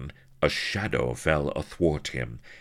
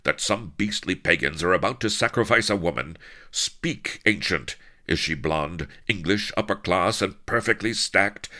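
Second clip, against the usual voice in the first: loud, higher indignant questioning; controlled fury; 5 semitones higher, 5 dB louder than usual. A narrator's reading. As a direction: interrogate with anger held in. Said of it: "roared Avery in controlled fury".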